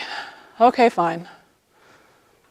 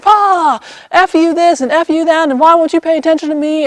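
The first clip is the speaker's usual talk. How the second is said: imitating a kid going nuts; 7 semitones higher than usual. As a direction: rattle off a furious cursing rant. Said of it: "going nuts".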